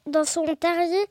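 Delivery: ordinary delivery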